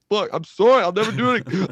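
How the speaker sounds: high, loud mock pleading; hammed-up frantic child apology. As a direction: beg like a kid in trouble. Child pleading after parent's disappointment.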